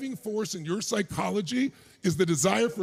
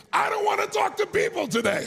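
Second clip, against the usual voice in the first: high, mock-whiny grumble; grumpy morning self, mocked; 11 semitones higher than usual. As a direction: play the sleepy grouch, hammed up. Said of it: "wake up in the morning and think".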